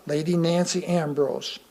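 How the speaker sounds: ordinary delivery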